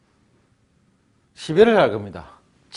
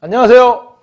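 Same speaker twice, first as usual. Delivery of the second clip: loud prompt; 8 semitones higher, 13 dB louder than usual. Shown as a demonstration. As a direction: call out 안녕하세요 high and loud. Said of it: "회화 한번 해볼까요?". (크고 높게 외치는 안녕하세요)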